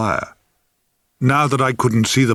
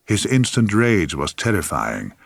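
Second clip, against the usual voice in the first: usual speaking voice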